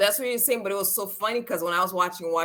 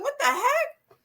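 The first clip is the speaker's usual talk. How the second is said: high laughing what the heck; laughing disbelief; slower than usual. Retold or imitated. Watching Eugene go off, high laughing disbelief. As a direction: squeak it out through laughter. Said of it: "I'm like".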